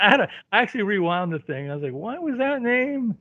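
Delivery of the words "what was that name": slow, high, incredulous question; puzzled re-enactment; mock disbelief. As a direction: draw it out, higher and softer, as if baffled. Re-enacting his disbelief at the credit.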